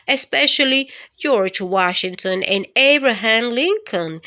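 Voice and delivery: normal talking voice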